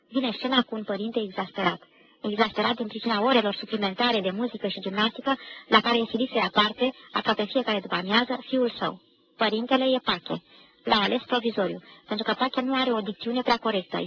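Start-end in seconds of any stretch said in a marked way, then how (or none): none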